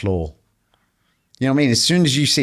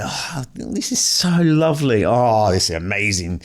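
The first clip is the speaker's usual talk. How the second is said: delighted. Retold or imitated. Reading flattering comments, delighted.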